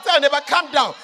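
loud, driving sermon voice; preaching at full force. Acted. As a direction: hammer it home to the congregation.

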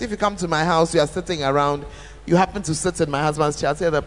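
ordinary delivery

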